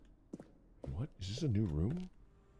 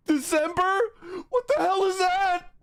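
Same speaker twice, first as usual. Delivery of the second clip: shocked; 10 semitones higher, 4 dB louder than usual. Real, not acted. Shocked by something in game.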